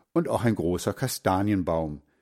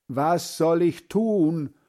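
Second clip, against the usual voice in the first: slow, higher, called out; calling louder; 7 semitones higher, slower than usual. Acted. Boy calling louder to his deaf grandfather. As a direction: repeat it up loud and slow for a deaf ear. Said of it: "ruft Hans Frieder".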